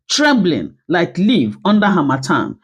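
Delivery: ordinary delivery, nothing marked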